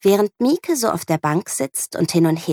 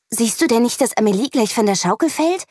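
a raised, scolding teacher's voice; 6 semitones higher than usual. A narrator's reading. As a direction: sharply scold the child. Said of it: "schimpft sie". (schimpfend, mit erhobener Stimme)

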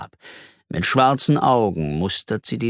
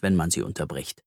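ordinary delivery, nothing marked

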